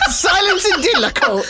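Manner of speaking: high, hammed-up English judge; shrieky mock courtroom command. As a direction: call the court to order in fake English. Mock judge calling for order.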